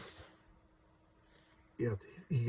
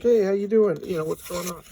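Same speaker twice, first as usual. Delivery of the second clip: higher, louder hail to someone in town; imitated shout of greeting; loud mock greeting; 6 semitones higher, 7 dB louder than usual. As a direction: call out a hearty hello. Acts out townspeople greeting Carl.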